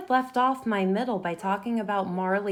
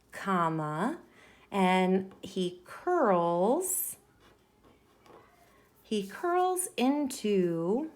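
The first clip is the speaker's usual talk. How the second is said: drawn out very slowly while writing; slow dictation; much slower than usual. Shown as a demonstration. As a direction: stretch each word out as you write it. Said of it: "I think I can combine these ideas".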